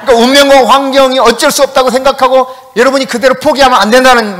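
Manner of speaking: loud, fervent sermon voice; preaching at full force. Acted. Pastor rousing the congregation to faith, loud and fervent. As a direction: proclaim it to the whole church.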